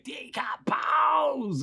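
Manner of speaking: high, long drawn-out mock yell; imitated toy monster yell. Toy monster yelling at the kids, mock yell. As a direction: stretch it into a high monster cry without much volume. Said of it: "They, like, would yell".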